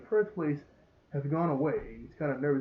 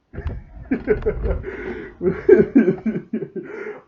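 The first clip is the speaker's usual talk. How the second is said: long, loud, high laughing fit; real helpless laughter; 6 semitones higher, 9 dB louder than usual. Real, not acted. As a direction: crack up and keep laughing. Cracks up watching the scene.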